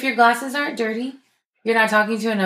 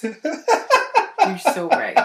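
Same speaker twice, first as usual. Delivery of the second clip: laughing; much slower than usual. Real, not acted. Laughing while placating.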